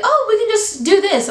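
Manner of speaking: high-pitched carefree friend imitation; mimicking privileged friend. Mimics privileged friends' careless suggestion.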